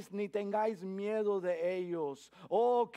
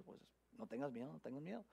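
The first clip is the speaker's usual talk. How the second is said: hushed; 3 semitones lower, 14 dB quieter, much faster than usual. Acted. Preacher hushes the repeated phrase.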